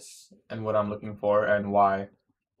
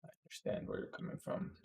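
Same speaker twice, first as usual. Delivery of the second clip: breathy whispered repeat; whispering; 18 dB quieter than usual. Shown as a demonstration. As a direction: whisper it back softly.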